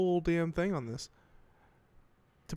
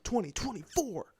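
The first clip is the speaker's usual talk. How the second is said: playful high-pitched hype squeal; excited; 7 semitones higher than usual. Pretend. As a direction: hype the year in a silly high voice. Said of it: "Very excited".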